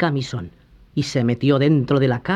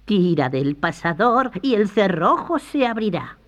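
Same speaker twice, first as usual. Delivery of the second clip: put-on higher, trusting old lady; trusting grandma; 6 semitones higher than usual. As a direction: answer with easy trust. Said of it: "respondió la abuelita muy confiada". (abuelita muy confiada)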